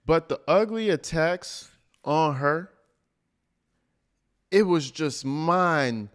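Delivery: mocking his cadence. Streamer mimics the clip speaker to viewers, mock sing-song. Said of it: "You see how he has to take a minute?".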